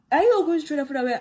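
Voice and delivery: fast, loud, high rebuke; quick loud high scolding; scolding senior. Retold or imitated. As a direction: snap at the newcomer for wasting food. Senior scolding new girl.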